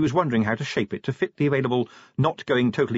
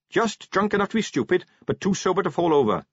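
higher-pitched, brisk officer reporting; eager, raised-pitch captain voice; earnest young captain; 8 semitones higher than usual. A narrator's reading. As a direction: report keenly to a superior.

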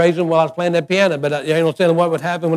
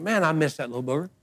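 quiet and tender, much softer; soft fond aside; 2 semitones lower, 10 dB quieter, faster than usual. Retold or imitated. Grandfather telling his wife he misses the grandson, soft. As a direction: murmur it fondly. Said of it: "I told Gail, I said".

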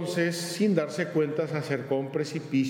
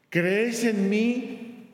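slow solemn question; 7 semitones higher, 3 dB louder, much slower than usual. Acted. God asks slowly.

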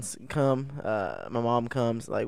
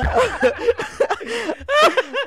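high helpless laughter; 4 dB louder than usual. Real, not acted. Cracking up at own impression.

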